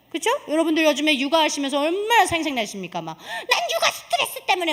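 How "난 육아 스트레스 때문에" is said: high-pitched mock whine of a stressed mother; hammed-up, high parenting complaint; complaining wife. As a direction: mimic a wife griping to her husband, pitched way up. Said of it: "막 남편들한테 넘쳐 그러시잖아요".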